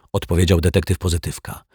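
ordinary delivery, nothing marked

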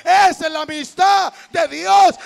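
high, shouted sermon rebuke; full-force preaching. Real, not acted. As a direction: preach it loud and high, hammering each phrase. (prédica a gritos, voz alta y aguda)

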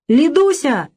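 high, tipsy call; drunken holler. A narrator's reading. Drunk host greets an old friend at the door, hollering. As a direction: holler the name like a merry drunk. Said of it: "опять заорал он".

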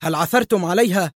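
heated, hopeful urgency; fervent exclamation. A narrator's reading. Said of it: "هتف به مايكل في حرارة".

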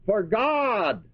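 God पर ज़ोर, falling firmly; stress on God. Shown as a demonstration. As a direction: lean hard on the word God. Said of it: "That's the emphasis".

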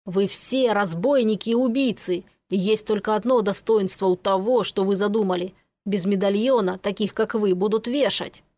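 ordinary delivery, nothing marked

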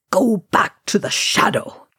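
grimly spat command. A narrator's reading. Wizard banishing the Balrog, grimly spitting. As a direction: spit the order at the foe. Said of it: "he spat".

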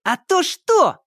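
high, cheeky challenge; cocky shout. A narrator's reading. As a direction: throw it back defiantly. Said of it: "задиристо крикнул Сенька".

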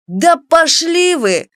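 high, drawn-out defiance; scared bluster. A narrator's reading. Cowed boy talks back to the gang, blustering. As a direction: put on bravado while backing down. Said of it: "пытался хорохориться струсивший Прыщ".

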